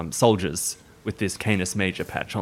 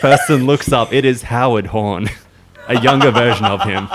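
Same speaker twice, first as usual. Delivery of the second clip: laughing; 4 semitones higher, 10 dB louder than usual. Real, not acted. Laughing through the narration.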